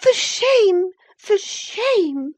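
very high, loud indignant cry; angry reproach. Acted. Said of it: "she repeated angrily".

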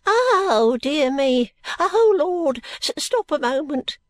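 high, loud, quavering outcry; flustered old man's shrieky protest; alarmed old man. Acted. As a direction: wail in panic, then stammer to stop. Old man panics at being lifted.

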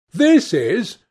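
eager interruption. A narrator's reading. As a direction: break in insistently. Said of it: "interrupted Dorian".

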